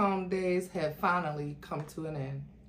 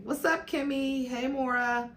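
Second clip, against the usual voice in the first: higher, breezy male character greeting; cheerful hello, pitched up; smiling greeting; 7 semitones higher than usual. Acted. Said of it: "Sean entered smiling".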